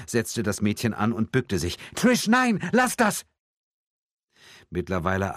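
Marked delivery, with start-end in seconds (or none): 1.9-3.2 high, loud mother's yell; sharp, high-pitched prohibition; urgent shouted order. A narrator's reading. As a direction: shout to stop your child at once. Mother shouts at daughter to stop.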